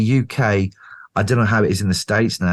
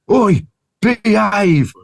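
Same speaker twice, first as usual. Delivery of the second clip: slow, much higher and louder call to order; 12 semitones higher, 5 dB louder, much slower than usual. Retold or imitated. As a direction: stop a fight with a slow commanding shout. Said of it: "I hear this voice comes out from the back".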